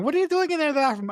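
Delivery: lightly mimicked mum, high and fast; a hint of an intrusive mum; imitated nosy mother. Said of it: "from Nina's mother going".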